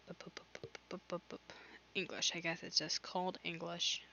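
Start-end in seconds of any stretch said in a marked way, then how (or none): 0.1-1.5 whispered mumbling. Real, not acted. Mumbling while looking it up.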